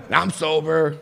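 ordinary talk, nothing marked

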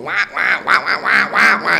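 imitated muffled babble, pitch swinging up and down; Charlie Brown wah-wah. Everyone talking while the two stare, Charlie Brown wah-wah. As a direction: honk nonsense wahs like cartoon grown-up chatter. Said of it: "You hear the Charlie Brown".

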